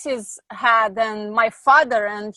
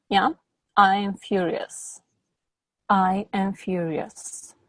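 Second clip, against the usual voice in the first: guided exercise; 2 semitones lower, slower than usual. Acted. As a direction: lead the client through it slowly. Guided anger-release exercise.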